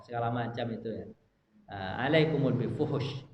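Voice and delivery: ordinary delivery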